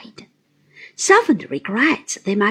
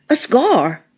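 indignant pride; 5 dB louder than usual. A narrator's reading. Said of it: "cried my aunt, with a kind of indignant pride".

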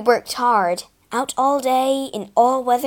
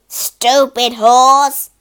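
much louder, slow angry grunt; slow loud angry growl; grunted insult; 2 semitones higher, 10 dB louder, much slower than usual. A narrator's reading. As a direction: spit out contempt at the horse. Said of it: "my master grunted".

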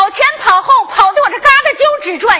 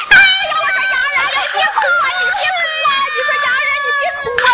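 shouting at her to stop crying; 9 semitones higher, much faster than usual. Acted. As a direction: yell over her sobbing to hush her. Hushing a crying girl.

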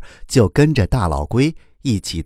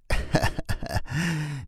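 soft, high chuckle; old turtle's chuckle; 6 semitones higher, 10 dB quieter than usual. A narrator's reading. Turtle pleased by the children's kind words, soft laugh. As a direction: chuckle quietly in character. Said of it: "大老龟笑了".

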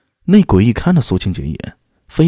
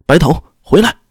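higher-pitched shouted call to come back; loud urgent call; 6 semitones higher, 4 dB louder than usual. A narrator's reading. Fighter calling back a comrade mid-retreat, urgent shout. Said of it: "一边退一边大声喊道".

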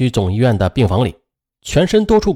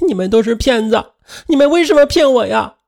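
put-on high wailing child voice; crying out; 10 semitones higher than usual. A narrator's reading. Daughter to both parents, wailing in tears. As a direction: cry out at the lying parents. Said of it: "女儿在哭喊道".

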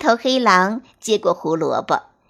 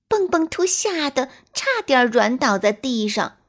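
ordinary delivery

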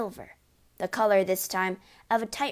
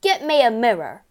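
very loud, urgent demand; yelled order; 3 semitones higher, 10 dB louder than usual. A narrator's reading. Father demanding mirror from wife, yelling. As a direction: yell for it furiously. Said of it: "the father yelled".

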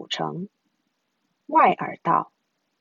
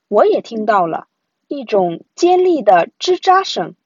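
usual speaking voice

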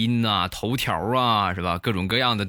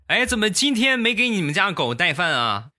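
high, bright village auntie calling out; nosy auntie; 9 semitones higher than usual. Retold or imitated. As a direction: chirp it up high like a chatty neighbour. Auntie's question voiced.

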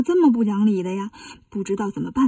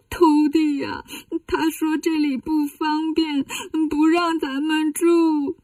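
slow, drawn-out whiny mock crying; tearful pout; 3 semitones higher, slower than usual. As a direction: wail pitifully to your disciples. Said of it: "唐僧一撇嘴". (撇着嘴拖长音哭诉)

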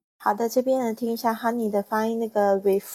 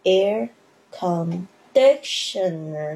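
"conditioner" drawn out slowly, the D syllable rising; teacher modelling stress slowly; much slower than usual. Shown as a demonstration. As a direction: rise into the "di" of "conditioner". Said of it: "我们要注意那个重音的部分".

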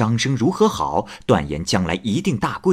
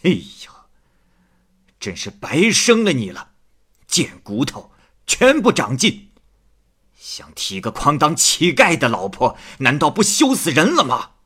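angry father scolding; 6 semitones higher than usual. Acted. Father scolds daughter for wanting poor suitor, angry raised voice. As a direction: berate the daughter hotly, voice climbing. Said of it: "王进士生气地说".

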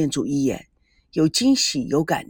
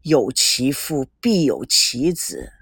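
put-on slow and low, old father's voice; weighty pronouncement; 6 semitones lower, slower than usual. A narrator's reading. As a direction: deliver the saying slowly and deeply. Father pointedly lectures son.

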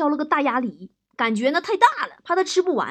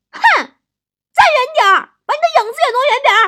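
huffy high-pitched shouting; hammed-up wife ordering her husband away; very angry; 13 semitones higher, 12 dB louder, much faster than usual. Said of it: "王嫂特别生气". (夸张地高声怒吼，赶人走)

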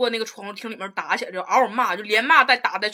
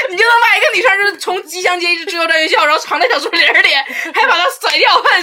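higher and louder, laughing through it; real laughter breaking the words; amused disbelief, laughing; 7 semitones higher, 9 dB louder than usual. Real, not acted. Laughing retelling absurd chase.

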